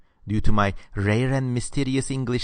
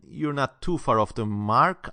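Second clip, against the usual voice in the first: ordinary talk, nothing marked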